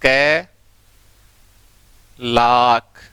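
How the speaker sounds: कै, then लाख as a long "laak"; correct pronunciation. Shown as a demonstration. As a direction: break it into कै and a drawn-out लाख, no r. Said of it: "सही उच्चारण क्या होता है".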